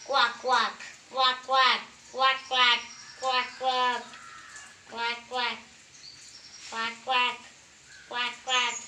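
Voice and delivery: quacks in quick pairs; imitated duck quacking; duck imitation. Shown as a demonstration. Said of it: "Next, duck".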